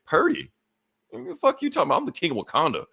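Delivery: high-pitched offended retort; acted-out affronted king, pitch jumping up; indignant disbelief. Retold offended retort to an insult.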